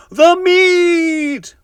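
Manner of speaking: very high, loud chorus roar; crew roaring. A narrator's reading. The crew roaring for meat at the table, high loud roar. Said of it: "we all roared".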